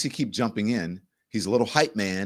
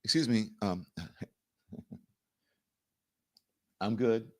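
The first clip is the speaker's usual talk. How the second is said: quiet put-down; 2 semitones lower, 7 dB quieter than usual. Retold or imitated. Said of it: "wouldn't you be like".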